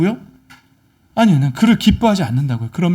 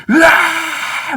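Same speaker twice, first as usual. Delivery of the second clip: long, high charging yell; acted battle roar, drawn out; battle cry; 10 semitones higher, much slower than usual. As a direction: yell like soldiers rushing forward. Soldiers charging behind their general.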